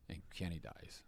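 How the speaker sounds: a sudden whispered punchline; dramatic whisper. Acted. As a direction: whisper it flat and low. Dramatic aside about a death.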